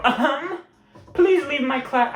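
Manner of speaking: high-pitched mock teacher scolding; old teacher's voice. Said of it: "I can't do her voice".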